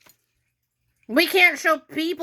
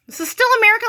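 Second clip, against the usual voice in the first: higher and louder, defiant call; rallying cry; 9 semitones higher, 5 dB louder, much faster than usual. Real, not acted. Urging viewers to fight for free speech, loud defiant rally. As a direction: rouse the crowd.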